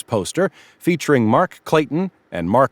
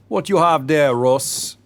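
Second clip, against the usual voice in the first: deep Caribbean lilt, pitched up; Caribbean shopkeeper's accent; 5 semitones higher than usual. A narrator's reading. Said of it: "He spoke in a deep Caribbean accent".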